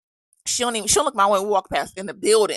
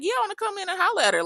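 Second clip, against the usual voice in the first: high, fast and eager; fired-up quick reply, high and fast; 12 semitones higher, faster than usual. Retold or imitated. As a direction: rush it out, ready to confront her. Fired up to confront teacher.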